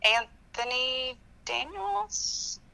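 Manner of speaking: slow, hesitant answer with a questioning lilt; tentative, drawn-out guess; unsure guess. Real, not acted. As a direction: stretch out the name, far from confident. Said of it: "you sound so confident".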